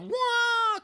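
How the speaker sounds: a high, loud cartoon "Wah!" for the splitting sea; mock sea-parting whoosh. Retelling the Moses movie to a friend, a high loud mock whoosh. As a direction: belt a silly high sound effect for the sea. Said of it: "He just went".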